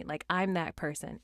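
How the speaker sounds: ordinary talk, nothing marked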